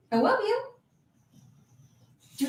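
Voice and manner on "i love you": high, swooping pet voice; tiny doting squeak of love; cooing affection. Real, not acted. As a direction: coo it sweetly to your dog, much higher. Doting on the dog.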